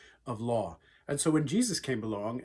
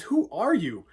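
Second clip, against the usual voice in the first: louder, higher startled shout; shocked cry; 6 semitones higher, 7 dB louder than usual. Retold or imitated. Saul startled, thrown to ground.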